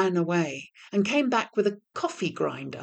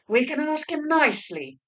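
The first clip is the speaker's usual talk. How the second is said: master devil; 9 semitones higher than usual. A narrator's reading. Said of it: "said the master devil".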